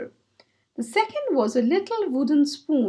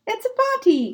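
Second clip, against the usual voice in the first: high, thrilled realisation; light up at the idea; excited; 9 semitones higher, 3 dB louder than usual. Acted. Hare reading the invitation, excited. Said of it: "cried Hare".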